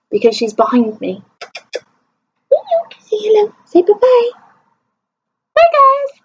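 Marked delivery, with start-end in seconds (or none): 2.5-6.2 high cutesy voice for the cat; pet voice. Pretend. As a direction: speak for the kitty, much higher and cute. Voicing the cat to viewers.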